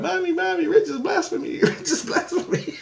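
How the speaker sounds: put-on very high child voice, tattling; squeaky mimicked three-year-old; imitated little girl. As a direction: tattle to Mommy like a tiny kid. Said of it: "And she's running".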